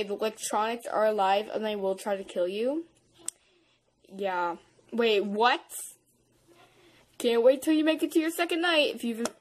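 ordinary delivery

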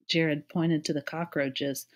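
ordinary delivery

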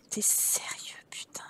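soft, voiceless disbelief; whispered irritation; exasperated whisper. Real, not acted. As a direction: hiss it under your breath, annoyed. Annoyed at the game.

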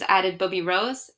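usual speaking voice

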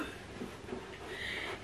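soft, breathy gasp; real gasp of emotion; overcome gasp. Real, not acted. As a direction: gasp quietly in awe. Overcome by the bag.

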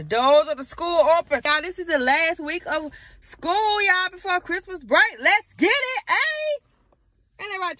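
loud, high, jubilant shout; hyped-up holler, voice climbing high; excited cheer. Real, not acted. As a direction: belt it out, celebrating. Celebrating last week of school to camera.

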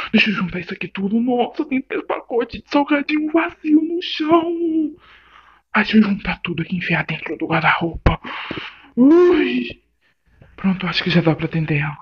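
fast, frantic tidying talk; hurried. Acted. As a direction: rush through it while cleaning. Arrumando a casa às pressas. (falando rápido, arrumando às pressas)

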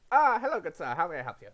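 a hint of a cheerful old shopkeeper; old shopkeeper greeting. Old man at the counter welcoming a customer, cheerful.

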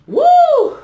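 high loud cry of delight; joyful whoop. Real, not acted. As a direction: let out a big happy whoop.

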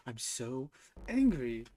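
drawn-out mock anger. Real, not acted. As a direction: drag out angry in playful frustration. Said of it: "I'm so mad".

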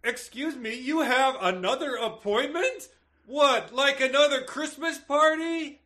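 repressed shout, high and strained; stilted, drawn-out high-pitched indignation; almost shouting. Shown as a demonstration. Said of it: "That's almost shouting".

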